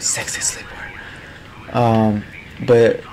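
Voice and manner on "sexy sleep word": whisper. Pretend.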